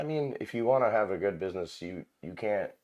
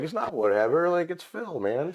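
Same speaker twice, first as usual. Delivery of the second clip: indignant retort; 9 semitones higher than usual. Retold or imitated. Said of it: "And I was like".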